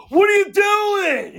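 high, drawn-out yell of disbelief; mock outraged yell. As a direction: imitate an exasperated dad yelling, stretched out. Acted outburst of Lori's dad.

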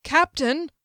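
high, loud call for attention; alarmed call. Acted. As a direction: call out sharply to your captain. Mage calling his captain in alarm.

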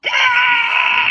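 loud, very high imitated kid shriek; toddler scream. Daughter planking in her car seat, shrieking high and loud. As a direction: screech like a furious toddler.

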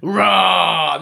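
mock monster roar, shouted; deep distorted monster roar. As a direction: bellow like a giant beast. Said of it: "what a dickhead is [name]".

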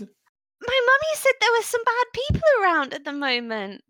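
put-on high, pouting little girl with arms crossed; a child threatening to tell her mum; stubborn orc child. Orc child crossing her arms at the adventurers, high and pouting.

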